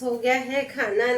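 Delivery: usual speaking voice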